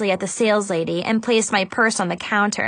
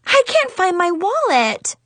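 higher, alarmed wail; panicked; 8 semitones higher, 3 dB louder than usual. Acted. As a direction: sound suddenly desperate. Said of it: "I finally cried".

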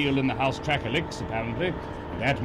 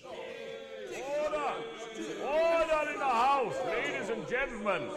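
calling order; 11 semitones higher, 6 dB quieter than usual. Acted. Speaker calling house to order.

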